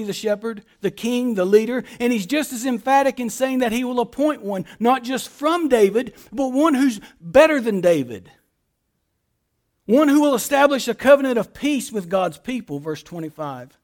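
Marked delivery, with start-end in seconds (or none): none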